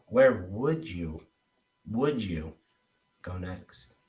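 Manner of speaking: slow "Where WOULD you", repeated, then a quiet "go next"; stress on WOULD YOU. Shown as a demonstration. Coach shows trainee where emphasis goes, WOULD YOU drawn out. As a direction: lean long on "would you" and let "go next" drop. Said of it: "I want you to put emphasis".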